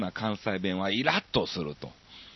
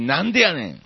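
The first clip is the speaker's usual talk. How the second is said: loud, high Kansai comeback; ツッコミ; 6 semitones higher, 5 dB louder than usual. Acted. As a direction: exclaim it at yourself like a comic straight man. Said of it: "これってツッコミと言えるのでしょうか". (自分に大声で関西弁のツッコミ)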